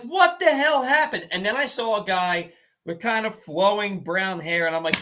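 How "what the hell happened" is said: high-pitched shocked question; can't believe the score changed; stunned disbelief. Retold or imitated.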